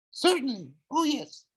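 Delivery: very high chirpy falsetto. Acted. Grover falsetto.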